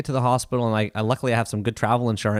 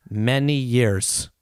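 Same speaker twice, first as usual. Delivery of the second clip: very slow, careful; much slower than usual. Retold or imitated. Doctor answering how to feel like the ocean, very slowly. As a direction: imitate a halting, sage-like answer. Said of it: "very, very slowly".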